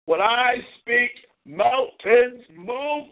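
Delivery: slow, swaggering decree; grandiose proclamation. Real, not acted. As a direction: proclaim it like a king. Grand boast.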